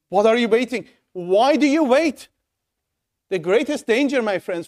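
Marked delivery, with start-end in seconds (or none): 0.1-2.3 urgent. Shown as a demonstration. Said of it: "In modern words".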